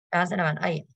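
ordinary delivery

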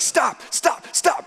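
high-pitched cry of stop; retold yelling. Runner to the dog bolting at him, yelling. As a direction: yell it as if warding off a charging dog. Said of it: "I was yelling, like".

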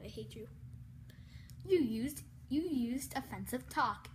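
ordinary talk

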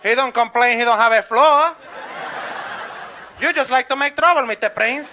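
loud, unbothered building owner; dismissive landlord. Acted. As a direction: brush off the tenant at full volume. Landlord brushing off the complaint.

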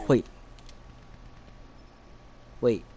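ordinary talk

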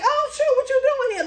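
high, loud greeting of surprise; a guard spotting an old face; surprised guard. Retold or imitated. Security guard spotting her in the hall, high surprise.

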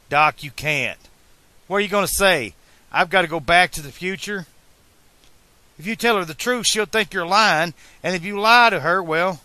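high, loud objection; exasperated arguing. A narrator's reading. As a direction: talk a stubborn friend out of it. Marty argues against Doc's goodbye.

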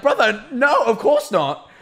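high, loud retold protest; incredulous. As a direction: dismiss it as obviously absurd. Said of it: "I genuinely was like".

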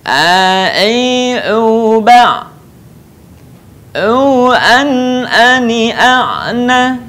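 "A, I, U, Ba" chanted, with "ani" slowly stretched; ain drill. Shown as a demonstration. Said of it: "Perhatikan contoh berikut ini".

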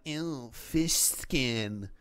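drawn-out, very slow mock disgust; hammed-up slow whine of someone grossed out by fish skin; mocking squeamish adults. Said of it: "whole ass adults are like".

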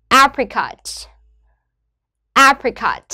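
"Apricot" with A- strong, -pricot falling; stress on first syllable. Shown as a demonstration. Teacher models word stress for students, A- stressed in "Apricot". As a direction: punch the A that opens "Apricot". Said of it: "Trọng âm rơi vào âm tiết thứ nhất là A".

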